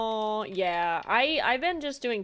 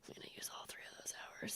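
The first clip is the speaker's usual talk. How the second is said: whisper; 21 dB quieter, much faster than usual. Retold or imitated. Joking about using all test hours.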